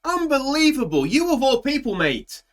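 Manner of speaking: high mock outrage that softens; from shrill mock fury to forgiving; anger melting away. Shouting at Ricciardo, then forgiving him.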